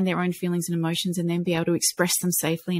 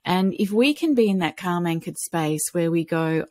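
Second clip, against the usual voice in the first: ordinary delivery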